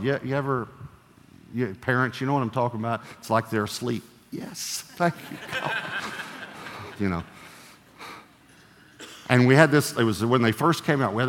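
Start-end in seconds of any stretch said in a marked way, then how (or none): 4.3-5.7 whispered, breathy relief, then a high squeak; mock relieved whisper. Parent whispering thanks to God once the kids sleep. 5.8-7.0 soft laugh. Real, not acted. Laugh after joke.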